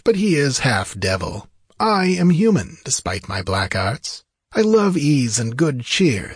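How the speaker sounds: theatrical sorcerer with swooping pitch; self-indulgent sorcerer. Acted. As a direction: play the worldly wizard, pitch leaping on key phrases. Narrator voices the sorcerer Peleus.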